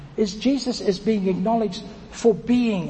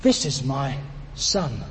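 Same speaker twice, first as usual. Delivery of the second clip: tender, quiet; 8 semitones lower, 5 dB quieter than usual. Pretend. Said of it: "God was saying to everyone everywhere".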